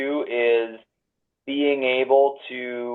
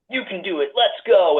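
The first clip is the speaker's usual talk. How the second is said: higher, bright pep-rally push; high-energy cheer; 8 semitones higher than usual. Retold or imitated. Young go-getter pumping up colleagues, excited cheer. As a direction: fire up the room like a hype coach. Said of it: "I'd have a ton of energy and I'd be like".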